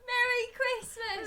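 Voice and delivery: high, slow mock Cockney Caine accent; hammed-up attempt at Caine's accent; Michael Caine. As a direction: call it out in a badly faked Caine accent. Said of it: "it's Michael Caine, so it's more like".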